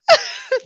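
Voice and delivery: sudden high cackle of laughter; high laugh burst. Real, not acted. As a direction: let out a high squealing laugh. Laughs at the joke.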